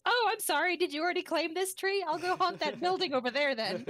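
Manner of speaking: high, laughing mock-polite voice; quoting Tony. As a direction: chirp it with a laugh, mock-sweet. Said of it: "He's like".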